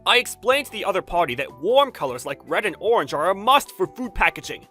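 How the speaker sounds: heated argument. Acted. Designer clashing with his boss over color, heated argument. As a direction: argue back hard and loud.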